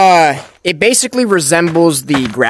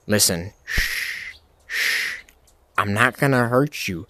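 hushed, gentle shushing; calm it down with soft shushes; soft hushing; 7 semitones lower, 10 dB quieter than usual. Pretend. Soothing the character in a hush.

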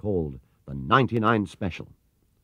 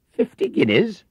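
much higher and louder, shocked; alarmed exclaim; 14 semitones higher, 9 dB louder than usual. Acted. Paddington shocked by the price, alarmed. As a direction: gasp at the price. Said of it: "exclaimed Paddington in alarm".

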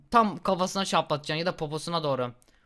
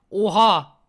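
loud startled oha; real loud surprise at the screen; shocked shout; 2 semitones higher, 9 dB louder than usual. Real, not acted. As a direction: blurt it out, caught off guard. Car suddenly runs man over.

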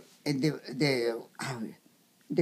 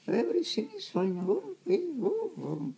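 much higher, soft and satisfied; a doctor happily reassured, pitched up; high pleased doctor voice; 11 semitones higher, 5 dB quieter than usual. Retold or imitated. Old doctor finding the child healthy, high soft pleased voice.

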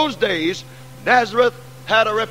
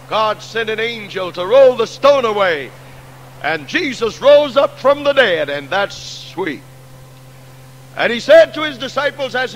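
triumphant revival preacher ringing out; preaching at full force; 3 semitones higher than usual. Acted. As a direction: build the hall to a shout of hope. Triumphant resurrection climax.

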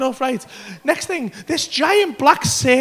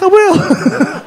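put-on high, loud childlike promise; eager agreement; 6 semitones higher, 5 dB louder than usual. Teller promising the serpent, high eager voice. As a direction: pipe up obediently.

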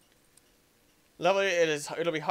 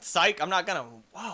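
usual speaking voice

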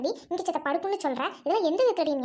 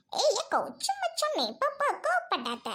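coaxing friend; 9 semitones higher than usual. Acted. Friend coaxes angry host.

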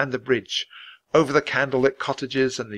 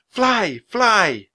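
urgent cry; 9 semitones higher, 3 dB louder than usual. Acted. Annie urging flight.

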